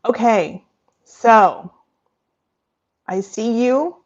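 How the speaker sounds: normal talking voice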